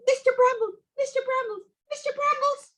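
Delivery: high rising calls; urgent calling. Acted. Girl calling the hedge sprite for help, high and urgent. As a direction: call out three times, frightened.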